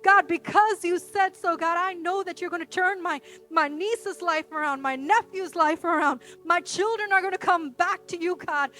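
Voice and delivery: forceful, driving sermon delivery; impassioned preacher building in intensity; fervent preaching. Real, not acted. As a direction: declare it boldly like a prayer of faith. Preaches a bold prayer at force.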